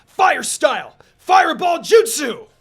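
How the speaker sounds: screaming attack call. Acted. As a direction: bellow the jutsu name as a battle cry. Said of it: "as he screamed".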